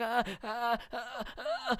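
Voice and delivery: high crying wails; imitating a kid's scream; imitated screaming cries. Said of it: "he started screaming".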